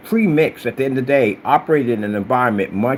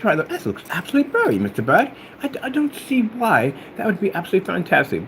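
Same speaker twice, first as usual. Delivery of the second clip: put-on English accent, higher pitched; 7 semitones higher than usual. Critiquing a screen to Mister Bud, English accent. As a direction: play a fussy English critic. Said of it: "don't ever think I'm mocking your, your, um, accent".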